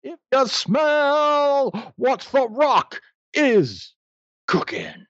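high, slow drawn catchphrase; The Rock impression. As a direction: boom it like a wrestler's promo.

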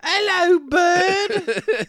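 hammed-up Cockney British hello; mock British accent. Podcast host mocking British listeners in a silly British accent.

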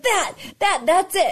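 high-pitched, eager recognition; excited realisation. Retold or imitated. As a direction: pounce on it as if it's the answer. Acting out their excited reaction.

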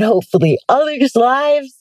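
speaking through a yawn; stretched out by a yawn. Real, not acted. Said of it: "I apologize for my yawning".

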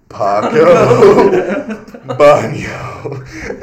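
Paco, a burst of laughter, then Baño yelled; laughing shout of Paco Baño; shouted through laughter. Retold or imitated. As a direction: yell it while cracking up. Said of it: "I'm like".

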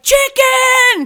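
high, loud mock-desperate shout; desperate yell. Starving guest blurts to the front desk, desperate yell. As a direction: yell it like a starving man's plea. Acting out desperately hungry comedian's plea.

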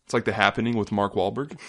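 ordinary delivery

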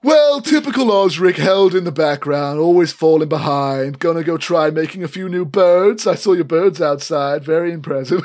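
loud taunting sneer; smug cousin. Acted. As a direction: belittle your cousin, gloating. Elfgeet mocks Osric.

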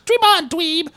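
high-pitched imitation of a scornful brush-off; mocking comeback. Retelling the woman's reply to a flirt, high mocking brush-off. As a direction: toss it out high, as a mock put-down.

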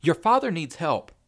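high, loud plea; desperate cry. A narrator's reading. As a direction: urgently insist on help. Frantic mother pleads with son.

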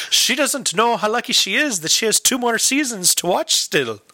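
imitating an Irish man's lilting remark; Irish husband. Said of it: "a little Irish lady and her husband".

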